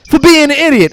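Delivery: hammed-up sketch retort; mock tough guy. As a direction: snap it back playfully. Voicing sketch character.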